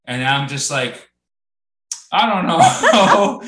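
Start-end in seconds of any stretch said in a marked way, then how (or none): 2.1-3.4 loud, high, long drawn-out wail; hammed-up "I don't know", stretched right out; exaggerated cluelessness. Shopper asked which beers he likes, hammed-up helpless wail. Acting out his flustered reply.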